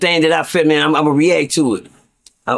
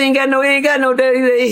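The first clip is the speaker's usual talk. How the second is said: much higher playful put-on voice; cheeky put-on voice; 9 semitones higher than usual. Young inmate joking back at the old man. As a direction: toss it off cheekily.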